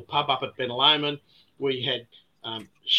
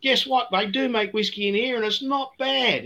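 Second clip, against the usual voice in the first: higher, called across a courtyard; calling out; 8 semitones higher than usual. Retold or imitated. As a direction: sing it out to someone outside. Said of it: "he sings out".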